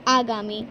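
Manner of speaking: आगामी with a long open first aa; correct long aa. Shown as a demonstration. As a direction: say आगामी, drawing out the first vowel. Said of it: "लेकिन वो असली शब्द क्या होता है हमारा?". (सही उच्चारण आगामी, लंबा आ)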